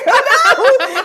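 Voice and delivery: very high, loud squeal of dismay; mock horror. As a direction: shriek it in comic panic. Told to scream again.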